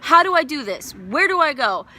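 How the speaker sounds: acted outburst of helpless pleading; screaming at God. Grieving mother demanding direction from God, loud desperate cry. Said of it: "I was screaming at God".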